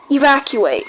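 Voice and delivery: usual speaking voice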